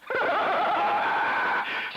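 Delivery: high, rattling laugh that falls away; taunting laugh. Real, not acted. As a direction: laugh at your rival, high and falling. Taunting Greg over the radio.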